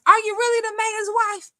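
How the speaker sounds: high, quick incredulous question; surprised question. Real, not acted. Host questioning a game winner's name, incredulous. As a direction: ask it in high-pitched disbelief. Questioning a chat member's username.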